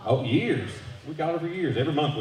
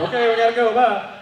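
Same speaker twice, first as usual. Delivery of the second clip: hurried goodbye; 11 semitones higher, 7 dB louder than usual. Retold or imitated. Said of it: "And it was".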